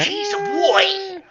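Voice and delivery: put-on high, slow Jersey accent gasp. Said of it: "in that Jersey accent".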